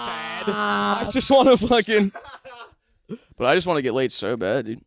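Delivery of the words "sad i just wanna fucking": high-pitched, exaggerated self-pitying whine; mock sad wail. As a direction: wail it like a hammed-up sad confession. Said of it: "and I'm sad".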